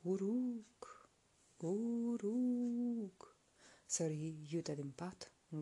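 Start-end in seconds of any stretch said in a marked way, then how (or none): 0.0-1.1 a far-off windy whisper of a name; ghostly whispered call; eerie whispered call. A narrator's reading. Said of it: "care parcă îl chema". 1.6-3.4 higher, louder, long drawn-out call; haunting far-off call; eerie distant call. A narrator's reading. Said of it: "care parcă îl chema".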